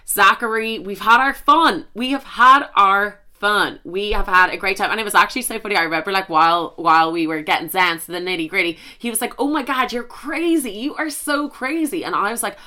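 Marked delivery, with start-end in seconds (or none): 0.1-4.9 loud, deliberate word by word; emphatic declaration. Retold or imitated. Retold declaration to Zachary. 9.4-12.0 put-on high, drawn-out American accent; American impression. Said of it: "American people just think".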